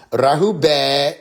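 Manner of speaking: Rahubat drawn out slowly, rising at the end. Shown as a demonstration. Showing how others say it, slow and rising. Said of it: "They go another tone".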